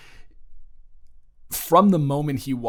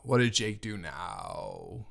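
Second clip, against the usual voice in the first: lower, softer, slow and tired; weary exasperation; 3 semitones lower, 7 dB quieter, slower than usual. Acted. Older sibling sighing over the boyfriend's latest mess, weary. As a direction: ask as if it's happened too many times. Weary sigh, then asks about Jake.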